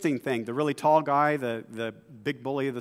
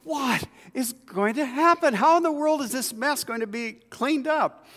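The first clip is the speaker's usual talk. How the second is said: dismayed wondering; 9 semitones higher than usual. Retold or imitated. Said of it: "you're left like".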